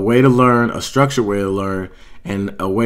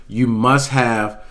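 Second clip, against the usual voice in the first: slow, with must leaned on hard; stress on must; much slower than usual. Shown as a demonstration. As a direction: drag out "You must have", pressing must. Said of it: "I put the emphasis on must".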